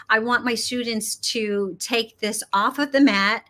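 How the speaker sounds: ordinary talk, nothing marked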